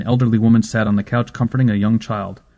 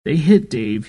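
put-on sniffling child; 6 semitones higher, slower than usual. A narrator's reading. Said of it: "he sniffed hard".

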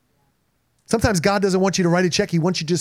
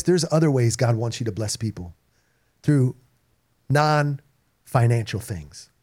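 normal talking voice

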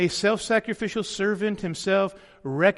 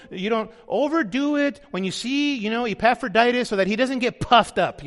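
mock arrogant Paul; 3 semitones higher, 3 dB louder than usual. Preacher imagining Paul scolding the church, mock-arrogant. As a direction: ham up a condescending warning. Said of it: "imagine if Paul took that stance".

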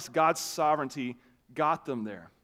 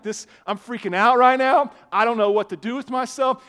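retold panic; 7 semitones higher, 10 dB louder than usual. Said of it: "I'm like".

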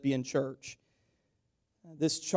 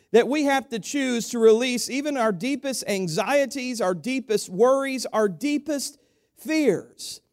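rising, louder preacher build; preaching; 7 semitones higher, 4 dB louder than usual. Acted. Pastor lifting the church with rising fervour.

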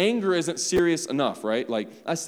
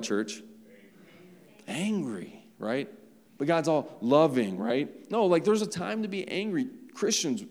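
usual speaking voice

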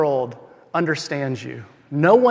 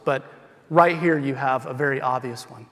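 ordinary talk, nothing marked

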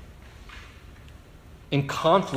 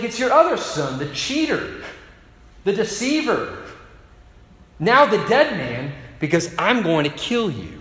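higher, louder mock threat; furious threat; 6 semitones higher, 3 dB louder than usual. Esau threatens his brother, higher and louder in mock fury. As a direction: spit out the vow of revenge.

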